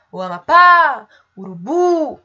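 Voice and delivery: long, ringing "pá" in "Amapá" and "bu" in "Urubu"; calling out. Shown as a demonstration. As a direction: call "Amapá" and "Urubu" as if to someone far away. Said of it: "se vocês fizerem esse exercício de chamar".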